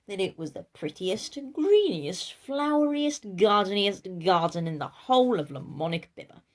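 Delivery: ordinary delivery, nothing marked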